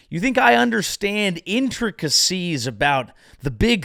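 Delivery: usual speaking voice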